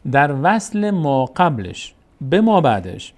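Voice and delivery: every word of در وصل ما قبلش به ما بعدش spaced out slowly and firmly; slow emphatic repeat. Shown as a demonstration. Teacher asking students to listen closely, slow and emphatic. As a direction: lay the rule down slowly, word by word. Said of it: "یک بار دیگه دقت کنید عزیزان".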